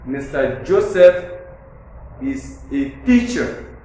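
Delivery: slow down and lean on "teacher" to emphasize it; emphatic stress. Shown as a demonstration. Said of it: "is being emphasized on".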